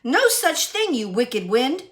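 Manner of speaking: high, loud scolding of the wind; defiant retort. Acted. Irene scolds the wind, high and defiant. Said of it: "she snapped".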